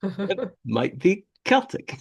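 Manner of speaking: Celtic with a K, high and slow; mock-precise, high KEL-tic for Celtic; hard K pronunciation.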